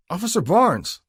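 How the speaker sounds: high, loud, tight with anxiety; anxious call. A narrator's reading. Rookie calling to his partner, anxious and tight. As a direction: call out nervously to stop him. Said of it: "the rookie called out, his voice tight with anxiety".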